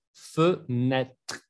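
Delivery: "fenêtre" as "feu... nètr"; two different Es. Shown as a demonstration. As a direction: split "fenêtre" to show both vowels. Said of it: "y después E". ("fenêtre" separado: "feu", "nètr")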